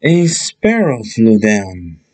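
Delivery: ordinary delivery, nothing marked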